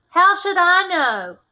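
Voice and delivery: high, loud and slow, defiant; defiant retort. Acted. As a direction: throw the question back at him, drawn out. Suspect snaps back at questioning.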